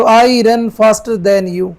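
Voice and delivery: very slow full sentence; slow dictation; slow read. Shown as a demonstration. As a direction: read the answer slowly. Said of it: "इसकी तुलना इससे की जा रही है".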